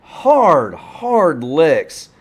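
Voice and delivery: high and loud, falling away; excited. Real, not acted. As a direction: wince at the hit out loud. Reacting to the crash.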